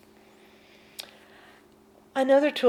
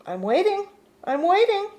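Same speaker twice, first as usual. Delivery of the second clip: taunting mind; 9 semitones higher, 3 dB louder than usual. Retold or imitated. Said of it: "The mind gets real".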